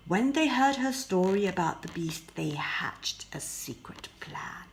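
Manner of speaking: ordinary delivery, nothing marked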